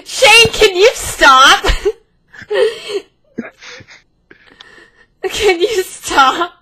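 pleading through high, loud giggles; slow, can't stop laughing; stifled giggles. Real, not acted. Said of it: "The giggling in the background is so difficult to keep a straight face".

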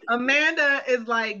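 ordinary delivery

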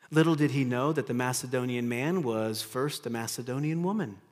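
usual speaking voice

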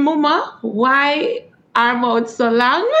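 the proverb in Jamaican patois, slow; slow Jamaican patois with a laugh at the end. Shown as a demonstration. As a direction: say it in Jamaican patois, drawing out long. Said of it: "say what it is now in Jamaican".

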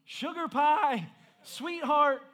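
loud, high, drawn-out pet-name call. Acted. Husband still calling his wife through her AirPods, loud and high. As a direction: keep calling out until they hear. Louder calls to unhearing wife.

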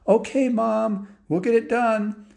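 put-on high, drawn-out kid voice; dutiful boys. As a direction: play boys placating their mom. Boys falsely promise mom in chorus.

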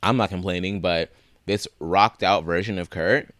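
ordinary delivery

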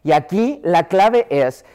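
ordinary talk

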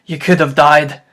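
loud, booming half-giant voice; loud rough gamekeeper voice; Hagrid voice. Acted. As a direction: boom it out like a big-hearted giant in tears. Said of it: "sobbed Hagrid".

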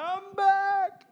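very high, squealed celebration; over-the-top giddy excitement, way up high; excited crowing. Acted. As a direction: crow it in a high, stretched-out voice. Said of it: "I was excited".

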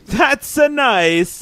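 high, slow, drawn-out Italian outburst; imitating a sudden Italian exclamation; Italian accent. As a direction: stretch it out in a big Italian accent. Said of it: "if you start talking like that randomly".

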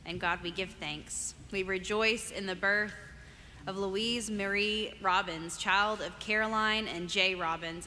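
usual speaking voice

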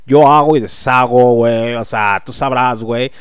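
smug provincial bragging, lightly voiced; cocky show-off. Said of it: "se la quieren creer así de".

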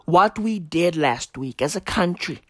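ordinary talk

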